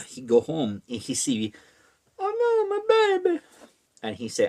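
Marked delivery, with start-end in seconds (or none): 2.2-3.4 very high falsetto wail; hammed-up cry of horror; mock dismay. Man finds baby in story.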